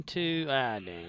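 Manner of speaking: ordinary delivery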